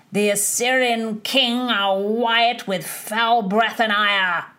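tipsy poet spouting a jeering rhyme at his friend, declaimed; spouting verse. Acted. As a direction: roll the mock verse out grandly. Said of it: "The Earl spouted".

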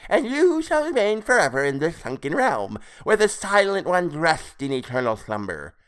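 high, loud, theatrical creature voice; insane glee. A narrator's reading. Creature dooming his captive to the sunken realm, insane glee. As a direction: proclaim it with mad relish. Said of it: "with insane glee".

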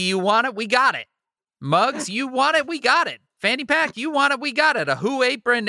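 playful sales patter with lifted catchphrases; merch ad read. Acted. Host plugging podcast merch to fans, upbeat sales patter. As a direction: pitch the goodies like an eager hawker.

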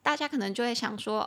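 ordinary delivery

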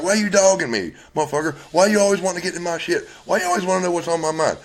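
mock accusing. Retold wife confronting him back.

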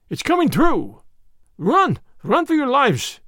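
high-pitched frantic warning; urgent shout of terror; alarmed cry. A narrator's reading. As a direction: cry out to make everyone flee. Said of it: "cried the Frenchman".

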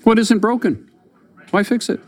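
high-pitched mock naive voice; mimicking someone who sees no problem; mimicked stock excuse. Voices legacy operators' stock excuse.

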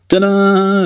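high mock fanfare. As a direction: announce it like a mock surprise. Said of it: "As if it's a surprise".

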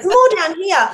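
mock Queens Bronx driver voice. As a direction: play the New York driver teasing her accent. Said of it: "Queens Bronx accent".

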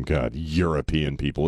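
ordinary delivery

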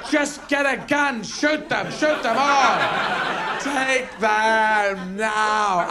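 high voice, last words hugely drawn out; fanatical dog. Acted. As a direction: urge your owner on as a zealous dog. Dog urges owner to shoot.

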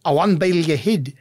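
a lightly put-on Scottish accent; English speaker copying Scottish; attempted Scottish. Shown as a demonstration. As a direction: try the Scots phrase "Awa and boil your head". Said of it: "I should do the accent".

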